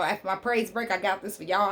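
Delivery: ordinary talk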